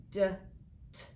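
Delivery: D as "duh", T as a bare puff; D and T as short consonant sounds, paused between; isolated letter sounds. Shown as a demonstration. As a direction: sound out D and T separately. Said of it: "I hear two more sounds".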